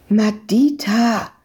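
slow, drawn-out call of a name. A narrator's reading. Searcher calls the girl once the men left, calling out. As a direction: call the child out of hiding. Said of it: "rief sie nun erneut".

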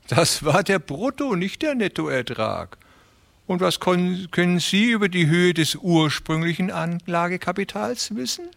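high, friendly, persuasive voice; smooth fat railway man. A narrator's reading. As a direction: talk them round with oily politeness. Beermann voice again.

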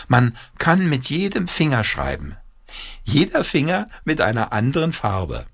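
put-on high, eager child's voice; excited boy with a new idea; inventive boy. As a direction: spin the idea out, pitched high. Peter spins out his idea.

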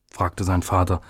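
ordinary delivery

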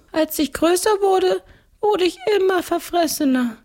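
very high ghost-count voice; gluttonous ghost count. Acted. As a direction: recount it as a high-pitched ghost nobleman. (hohe Stimme eines Gespenstergrafen)

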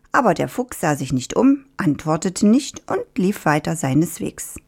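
usual speaking voice

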